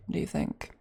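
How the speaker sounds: usual speaking voice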